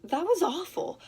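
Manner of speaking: high-pitched, disapproving jab; rude tone. Shown as a demonstration. Speaker showing how not to criticise a partner, rude and disapproving. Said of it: "in a rude way".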